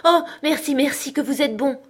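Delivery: high, loud relieved thanks; cried out. A narrator's reading. Cousin thanking her cousin for the promise, loud relieved cry. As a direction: burst out in grateful relief. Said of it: "Elle s'écria".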